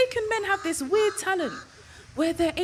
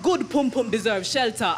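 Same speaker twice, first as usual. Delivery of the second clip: lower, Jamaican accent, lightly voiced; a hint of Winston's Jamaican patois; Winston's Jamaican line; 6 semitones lower than usual. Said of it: "Winston turned to me and said".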